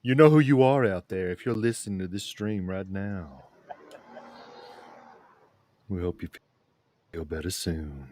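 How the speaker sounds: soft, deep late-night radio DJ; deep sultry voice. Acted. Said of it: "I call that voice, the deep, sultry voice I was using".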